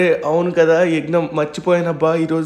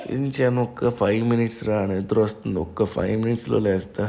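much lower and softer, drowsy; groggy big brother pleading for five more minutes; sleepy mumble; 7 semitones lower, 6 dB quieter than usual. Acted. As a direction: mumble from under the blanket. Half asleep, begging five minutes.

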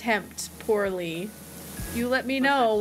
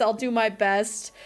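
normal talking voice

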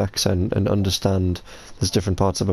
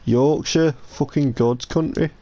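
put-on Yorkshire accent; 5 semitones higher, slower than usual. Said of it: "do a Yorkshire accent".

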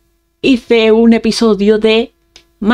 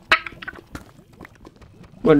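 ordinary talk, nothing marked

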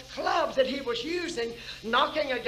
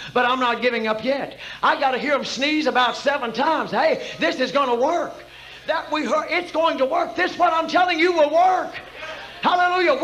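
loud preaching climbing to a high, shouted peak; building full-force preaching; 5 semitones higher, 11 dB louder than usual. Real, not acted. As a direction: rouse the congregation, louder and higher toward hallelujah.

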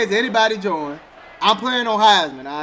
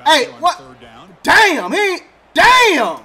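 startled yell at the screen; real shout; 6 semitones higher, 4 dB louder, much slower than usual. Real, not acted. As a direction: shout it out in disbelief. Reacting to play, shouting.

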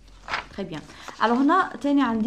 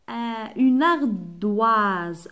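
une ardoise drawn out; slow model word; 5 dB louder, much slower than usual. Shown as a demonstration. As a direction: stretch une ardoise for the class. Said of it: "نسحقوا غير wa". (une ardoise ببطء)

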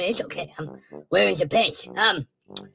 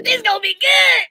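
gleeful shout; 11 semitones higher, 5 dB louder than usual. Acted. Mock roast of Biden.

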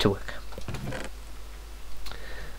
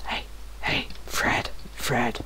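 whispering; 7 dB quieter than usual. Real, not acted. Whispering to Fred from below.

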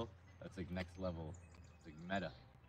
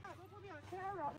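high, quick mock protest from a shy friend; 9 dB quieter, faster than usual. Imitating a camera-shy friend, high and fast. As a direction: squeak it like a friend dodging the camera. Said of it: "then he's like".

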